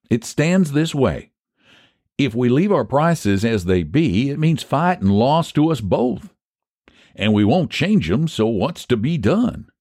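folksy reasoner Phineas. Acted. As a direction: lay out the problem in rustic fashion.

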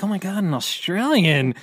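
high-pitched mock amazement; mock amazed fans. Host imitates fans hearing an Australian, mock amazed. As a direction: gush like astonished Australian fans. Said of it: "They're like".

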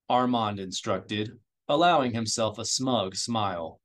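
ordinary delivery, nothing marked

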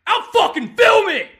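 high, very loud threat; threatening shout. Real, not acted. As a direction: yell it as an ultimatum. Furious threat shouted on call.

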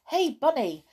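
high, loud hail; calling out. A narrator's reading. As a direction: call over to catch someone's attention. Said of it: "called to him".

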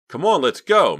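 high, loud, urgent plea; pleading. A narrator's reading. Mickey pleads with Clay to leave, urgently. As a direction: beg to get out right away. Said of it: "Mickey pleaded".

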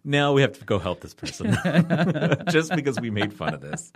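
loud and laughing; guilty giggles through it. Real, not acted. Laughing guilt over the joke.

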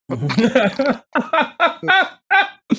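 hearty, high burst of laughter; big laugh. Real, not acted. Reacting to his son's joke.

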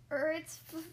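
flustered, halting answer; stammering. A narrator's reading. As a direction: stumble on the first sound. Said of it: "I stammered".

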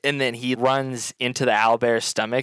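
ordinary delivery

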